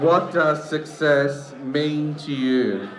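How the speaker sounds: slow and deliberate for the crowd; slow, clear repeat. Shown as a demonstration. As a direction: read it out slowly. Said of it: "Mama Shell said".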